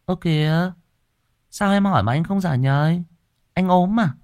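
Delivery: high, worried questioning; a wife fretting over a silent husband; worried wife asking if he's ill; anxious wife. A narrator's reading. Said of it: "Chị Hoàn lại càng sốt ruột".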